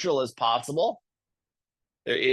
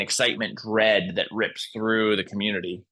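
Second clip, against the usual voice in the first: usual speaking voice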